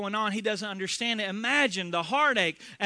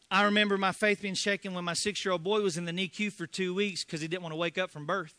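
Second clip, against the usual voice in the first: ordinary delivery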